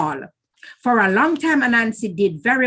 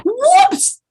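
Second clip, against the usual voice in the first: whoops; 6 dB louder than usual. A narrator's reading. Anansi falls into the trap.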